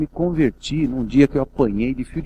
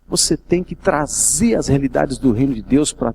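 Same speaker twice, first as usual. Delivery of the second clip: ordinary delivery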